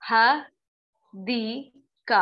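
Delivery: word split with breath breaks; broken into sounds. Shown as a demonstration. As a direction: say ह, दी, का as separate slow pieces. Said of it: "sound का मैंने सांस लिया, जैसे कि मैंने बोला".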